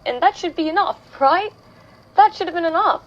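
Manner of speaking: trying to convince yourself, voice lifting; insistent self-reproach. Acted. Self-reproach in an acted monologue.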